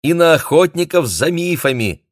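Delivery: high, loud and gleeful; joyful. A narrator's reading. Шао cheerfully adds myth hunters to the list, joyful. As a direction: chime in happily at full brightness. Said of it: "радостно добавила Шао".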